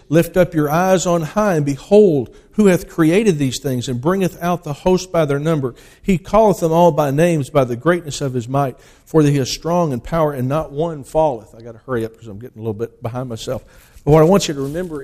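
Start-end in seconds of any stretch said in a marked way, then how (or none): 0.1-11.5 louder, proclaiming each line of the King James text; booming pulpit reading; preaching scripture. Acted. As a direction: call it out to the congregation. Reads Isaiah aloud from the pulpit.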